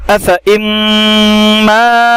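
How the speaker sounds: अफ़ इम्माता recited slowly, high, without the alif; Quranic recitation. Shown as a demonstration. Teacher showing students how to read it, slow recitation. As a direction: chant अफ़ इम्माता in drawn-out tajweed. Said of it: "देखिए इसमें हमने अलिफ को नहीं पढ़ा".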